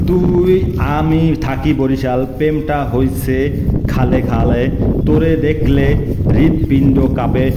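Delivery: a forceful poem reading, words pushed out; loud recitation. Acted. Reciting a Barishal love poem to his sweetheart, loud declaiming.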